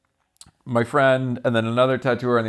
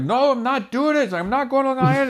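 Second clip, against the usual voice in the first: mock cabbie, much higher, refusing; cab driver refusing; 14 semitones higher than usual. Cab driver refusing the drunk passengers, mock high voice. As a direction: play the stubborn driver, pitched way up. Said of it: "yelling and fighting".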